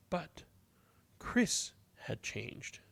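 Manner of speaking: soft, slow whisper; slow, hushed storybook close; hushed ending. A narrator's reading. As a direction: whisper the turning point. Quiet ending realization.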